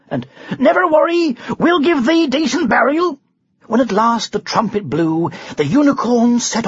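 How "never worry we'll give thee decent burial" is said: loud, much higher cry; crowd cheering. Acted. The crowd cheers the underdog knight, loud cry. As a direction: call out encouragement. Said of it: "they cried".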